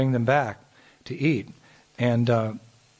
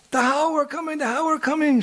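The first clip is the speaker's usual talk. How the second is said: mock terrified cry. Mother fooling the boys, high hammed-up fright. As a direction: cry out in pretend terror, much higher. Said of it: "so afraid of what she saw".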